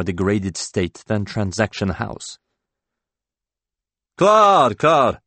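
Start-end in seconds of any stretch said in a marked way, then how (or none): 4.2-4.8 loud, high shout of a name across the pub; calling out. Acted. Drunk colleague calls across pub. 4.8-5.2 loud, high repeated call of Claude; calling again. Acted. Drunk colleague calls across pub.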